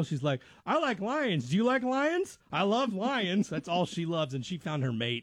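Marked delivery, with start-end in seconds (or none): 0.7-3.5 higher-pitched imitation of a girl; little girl. Imitating show dater sharing her love of lions, little girl. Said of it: "that one little girl".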